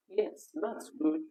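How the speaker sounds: ordinary delivery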